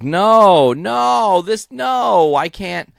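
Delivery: high, drawn-out angry protest; pissed Rorschach. Acted. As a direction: wail each NO high, acting out outrage. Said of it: "Rorschach is pissed. He's like".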